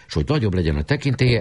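plain reading voice

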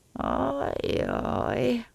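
high, drawn-out woeful sighs; sighing lament. A narrator's reading. Traveller left behind by the train, sighing lament. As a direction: let out long sighs of misery. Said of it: "sóhajtozott".

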